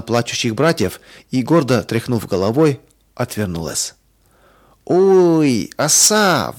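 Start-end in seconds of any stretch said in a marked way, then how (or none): 4.8-6.5 sudden high, louder yelp; piercing cry. A narrator's reading. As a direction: cry out in alarm at a sting. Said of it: "вдруг пронзительно закричала Оля".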